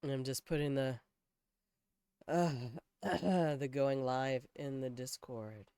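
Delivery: a sultry morning voice. Real, not acted. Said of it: "Oh, I sound so sultry".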